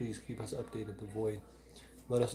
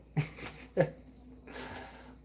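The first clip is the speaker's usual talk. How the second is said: breathless laugh; 5 semitones higher than usual. Real, not acted. Laughs after singing.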